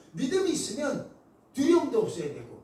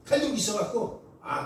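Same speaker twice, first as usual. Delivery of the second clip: normal talking voice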